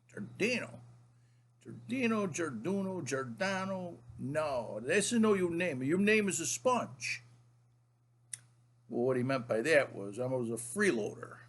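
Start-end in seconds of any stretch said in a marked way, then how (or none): 0.1-7.2 high-pitched mock grandfather, toying with the surname; grandpa impression. Grandfather rejects grandson's surname, hammed-up grandpa voice. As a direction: imitate grandpa puzzling over a name, then pronouncing judgment. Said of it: "he would look at me and say".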